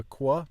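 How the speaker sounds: "qua" said as kwah, with a W glide; KW sound. Shown as a demonstration. Learner explains Italian QU, KW sound. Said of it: "seems to be more of".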